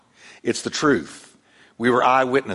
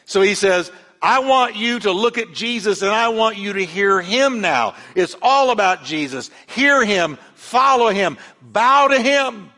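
fervent preaching; 7 semitones higher, 4 dB louder than usual. Acted. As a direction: lift the church up with it. Preaching at full force.

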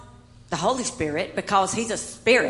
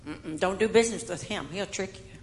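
gossiping townsfolk; 5 semitones lower, 7 dB quieter than usual. Acted. Townsfolk gossiping about Jacob.

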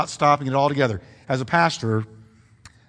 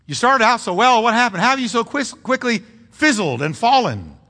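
raised, pleading rebuke to a straying friend; pretend confrontation; 5 semitones higher than usual. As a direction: call out the friend who quit, pitch up. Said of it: "I could easily say".

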